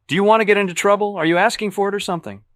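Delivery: heated challenge. A narrator's reading. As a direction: fire questions at him, fed up. McDermott confronting Bateman.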